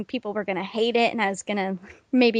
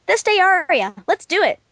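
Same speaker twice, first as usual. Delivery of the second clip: higher, lightly excited self-quote; excited idea; 8 semitones higher, 4 dB louder than usual. Retold or imitated. Retelling own idea for a pony song, mildly excited. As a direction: pitch it up like a sudden bright idea.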